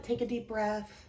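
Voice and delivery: ordinary talk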